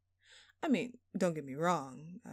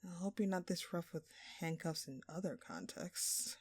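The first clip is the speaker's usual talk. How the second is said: ordinary delivery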